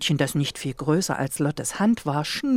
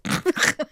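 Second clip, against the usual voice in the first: burst out laughing; 12 semitones higher than usual. A narrator's reading. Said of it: "prustete laut heraus".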